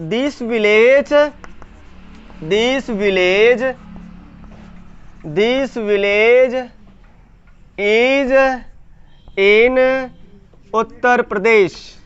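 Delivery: very slow, long gaps between words; slow dictation. Shown as a demonstration. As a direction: stretch each word as you write it. Dictates sentence while writing it.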